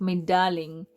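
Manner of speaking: lightly imitated foreign accent, maybe Spanish or Italian. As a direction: put on a hint of a Mediterranean accent. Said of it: "it's in a accent".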